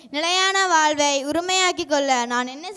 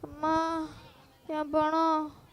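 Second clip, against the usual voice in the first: pained moaning. Acted. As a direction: groan weakly about the pain. Beaten man moaning.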